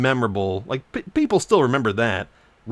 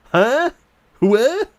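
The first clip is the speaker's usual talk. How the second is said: high, loud shocked "huh, what"; shocked disbelief; 12 semitones higher, 7 dB louder than usual. Real, not acted. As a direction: react in total disbelief. Shocked by copyright claim.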